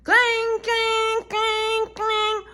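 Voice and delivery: high loud clanging bell; alarm clang. Acted. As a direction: ring it out like an alarm. Fire alarm goes off.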